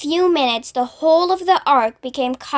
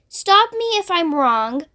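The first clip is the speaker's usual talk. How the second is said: usual speaking voice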